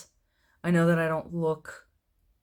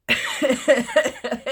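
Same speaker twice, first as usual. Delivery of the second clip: a long burst of laughter; high laugh; 9 semitones higher, 6 dB louder than usual. Real, not acted. Laughing after admitting the tone to viewers. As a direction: laugh at your own admission.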